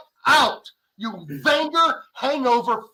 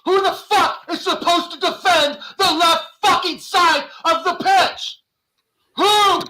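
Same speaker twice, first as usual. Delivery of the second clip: louder, higher indignant shout; furious fan shouting at the manager; angry outburst; 6 semitones higher, 5 dB louder than usual. Real, not acted. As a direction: demand it, voice climbing. Raging at the line-up.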